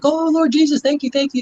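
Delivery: high, imitating a patient gasping thanks; grateful patient. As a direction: go high, mock heavenly relief. Patient waking from anesthesia.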